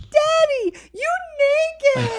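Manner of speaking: put-on high, loud toddler exclamation; befuddled toddler. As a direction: imitate a small child shocked at Dad. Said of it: "like, befuddled".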